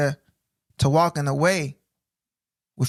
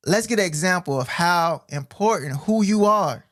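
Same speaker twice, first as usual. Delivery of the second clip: ordinary delivery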